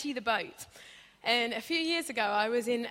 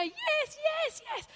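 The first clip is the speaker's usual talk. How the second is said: squeaky giving in; slower than usual. Retold or imitated. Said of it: "So you're saying I'm the winner?".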